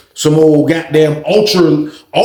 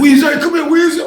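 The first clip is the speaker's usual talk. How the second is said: calling out; 12 semitones higher than usual. Retold or imitated. Friends calling him over.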